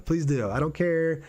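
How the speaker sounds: normal talking voice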